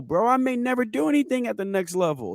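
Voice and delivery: usual speaking voice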